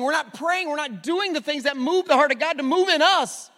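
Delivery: loud, fast, driving sermon climb; full-force preaching. Acted. Pastor urging the church toward prayer, loud full-force preaching. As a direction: preach it hard and fast to the whole room.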